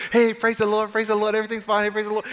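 high, monotone mock churchgoer greeting; fake cheerfulness. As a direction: chant empty praise as if all is fine. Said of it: "play fake like it's all fine and good".

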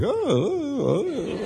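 high, soft swooping "ohh"; coy little rising-and-falling oohs; flattered cooing "oh". Retold or imitated. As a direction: play up being bashfully flattered. Acting out flattered reaction in airport.

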